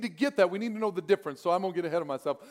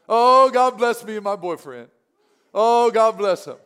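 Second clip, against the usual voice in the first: louder, gushing mock plea about a boyfriend; mock needy prayer; 8 dB louder than usual. Imitating someone praying over her boyfriend, loud and gushing. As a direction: ham up a lovestruck prayer. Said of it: "Or do you pray so that".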